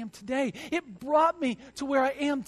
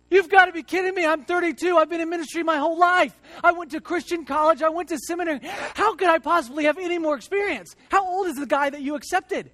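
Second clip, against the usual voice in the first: much higher and louder, furious rant; angry outburst; 6 semitones higher, 7 dB louder than usual. Retold or imitated. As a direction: shout your outrage at the rejection. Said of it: "I was just so mad at God".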